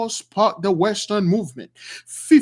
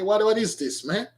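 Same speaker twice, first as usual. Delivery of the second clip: ordinary talk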